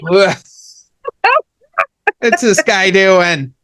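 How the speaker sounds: a half-hearted grumble at another driver; mild road rage. Retold or imitated. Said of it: "get really upset and do the old".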